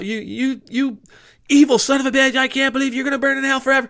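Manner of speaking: high, loud, fast ranting damnation; hammed-up fire-and-brimstone yell at a car; mock furious yell. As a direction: yell it like a preacher cursing a broken car.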